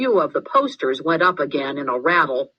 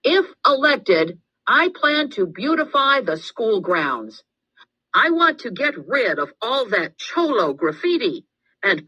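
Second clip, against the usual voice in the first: booming; 7 semitones higher than usual. A narrator's reading. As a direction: project it big to fill the room. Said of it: "She inflated her lungs and boomed".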